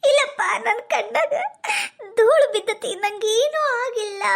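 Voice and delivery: high, drawn-out sobbing denial; hammed-up weeping while insisting all is fine; put-on crying. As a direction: wail slowly through tears. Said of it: "ಅಳಬೇಡ".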